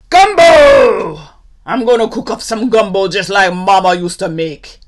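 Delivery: booming, excited outburst; loud cry. Acted. Hot gator announces Mama's gumbo, crying out. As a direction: burst out loud, then race on. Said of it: "he cries".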